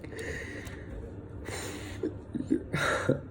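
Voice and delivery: drawn-out trembling sigh; long heavy sigh. Real, not acted. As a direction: breathe out long and heavily. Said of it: "Uh, sorry, hold up".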